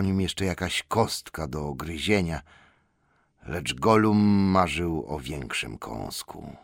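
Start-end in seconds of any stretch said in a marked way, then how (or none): none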